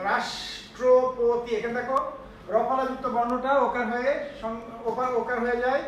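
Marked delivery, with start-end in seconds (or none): 0.1-1.5 রাষ্ট্রপতি drawn out very slowly, each syllable; slow pronunciation. Shown as a demonstration. Teacher slowly modelling a word for students. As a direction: spell out রাষ্ট্রপতি slowly, sound by sound. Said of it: "উচ্চারণ হচ্ছে রাষ্ট্রপতি".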